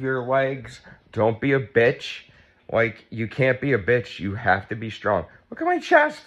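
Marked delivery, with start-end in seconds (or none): 5.5-6.2 very high, strained squeal of shock. Real, not acted. Woman seeing her new chest, high shocked squeal. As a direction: shriek it in disbelief. Shocked at own surgery result.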